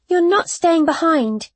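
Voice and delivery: high-pitched, urgent objection; quick-rising protest; insistent. A narrator's reading. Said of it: "I repeated more firmly".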